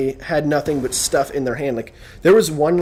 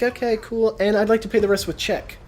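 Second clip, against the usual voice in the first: mock breezy customer; 7 semitones higher than usual. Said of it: "and she's like".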